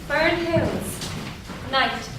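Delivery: ordinary talk, nothing marked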